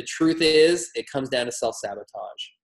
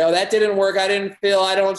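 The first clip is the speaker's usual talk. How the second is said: higher, louder mock complaint; hammed-up client protest, pitched up; complaining client; 6 semitones higher, 5 dB louder than usual. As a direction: whine that it failed. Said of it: "they'll do it once and say".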